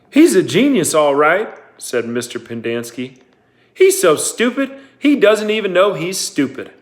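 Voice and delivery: mock him for the whole crowd; loud mocking sneer. A narrator's reading. Pendanski mocking Zero loudly.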